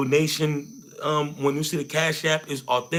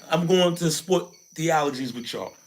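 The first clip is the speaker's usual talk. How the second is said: ordinary talk, nothing marked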